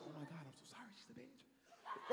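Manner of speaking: soft, hushed attendant aside. Retold or imitated. Flight attendant sneaking an aside to him, hushed. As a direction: murmur it under the breath. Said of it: "She was like".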